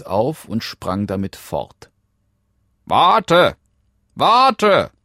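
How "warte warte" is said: high, loud frog shout; calling out. Acted. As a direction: yell after someone who is leaving. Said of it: "rief der Frosch". (laut hinterherrufender Frosch)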